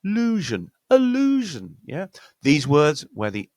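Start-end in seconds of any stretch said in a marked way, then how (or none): 0.0-0.7 "illusion" minus the first vowel, LOO-zhun, high; word without its schwa. Shown as a demonstration. Teacher shows the rest of the word, "illusion" as LOO-zhun. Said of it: "the rest of the word is". 0.9-1.8 "illusion" as uh-LOO-zhun, high and loud on LOO; schwa, second-syllable stress. Shown as a demonstration. Teacher models the whole word, "illusion" as uh-LOO-zhun. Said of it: "stress point is always gonna be on the second syllable".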